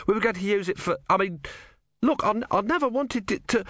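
high, stammering, bewildered; flustered inventor. Acted. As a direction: splutter in nervous protest. Ern defends his machine.

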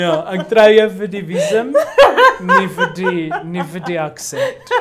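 talking through laughter; words broken up by laughing. Real, not acted. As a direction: tease through helpless giggles. Joking marriage offer amid loud laughter.

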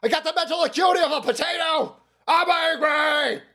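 high, hammed-up shouting old man; mock Biden, high and yelling; mock Biden tantrum. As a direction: squeal it out like a cranky toddler. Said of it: "He goes".

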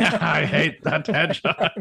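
laughing through mock hatred. Real, not acted. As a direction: chuckle the words out slowly.

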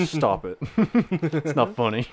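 mock protest dissolving into laughs; slow, laughing through the complaint; anger turning to laughter. Real, not acted. Protests while cracking up.

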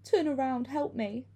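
high, tearful cry for help; sobbed plea. A narrator's reading. Limping woman begs to be seen, sobbing. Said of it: "the woman sobbed".

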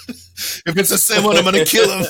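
mock menace spoiled by laughter; laughing mock threat. Quoting the film's funniest threat to a co-host, giggling. Retelling a film joke, laughing.

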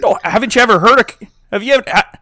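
put-on loud, incredulous Canadian accent; mock Canadian accent. Host challenging a co-host on the accent, incredulous Canadian. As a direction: push back in a put-on Canadian voice. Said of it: "They sound awfully like this".

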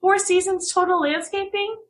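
can't believe they don't know; incredulous. Real, not acted. Incredulous at friend not knowing.

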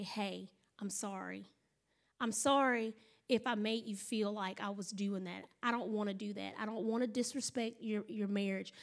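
soft, low, conciliatory; quiet, soothing peacemaking; gentle apology. Acted. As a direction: model a humble apology to an offended woman. Models a gentle apology to wife.

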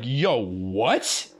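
low start swooping up into a question; retold astonishment. Boss hears about the kitchen fight, imitated astonishment. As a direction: imitate a boss's stunned question.

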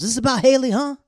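loud, high-pitched challenge; acted accusation. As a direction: blurt it out as a jealous accusation. Acting out a jealous accusation.

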